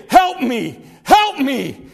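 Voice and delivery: urgent plea, punched out; pleading sinner. Acted. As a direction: cry out for help to a savior. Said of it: "come like a leper to our Savior".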